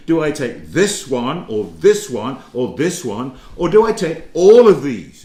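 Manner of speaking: deliberate choosing, "all" swooping up loud; acted weighing of choices. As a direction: build to a big, loud punch on "all of these". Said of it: "you could be saying to yourself".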